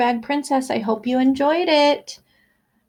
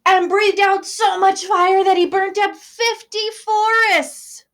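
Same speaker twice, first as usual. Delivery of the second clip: dramatic fire blast; 8 semitones higher, 5 dB louder than usual. A narrator's reading. Dragon breathes out fire.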